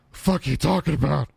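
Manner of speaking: gruff comeback in a put-on voice; Tourette's Guy. Dad snapping back at his son, mock irritated. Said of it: "Tourette's guy".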